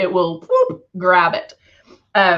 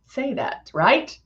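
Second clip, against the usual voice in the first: ordinary talk, nothing marked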